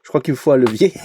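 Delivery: ordinary talk, nothing marked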